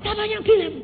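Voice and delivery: high, sharp demanding question; sister's question. Retold or imitated. Sister challenges the speaker over the phone, high and sharp.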